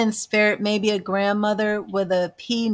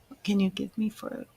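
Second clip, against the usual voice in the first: soft whisper, fading to barely audible; whispered invocation; 9 dB quieter than usual. Real, not acted. Medium asking spirit what it has for Verity, hushed whisper. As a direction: quietly ask the unseen, almost to yourself.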